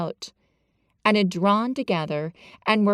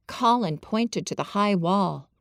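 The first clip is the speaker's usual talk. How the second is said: usual speaking voice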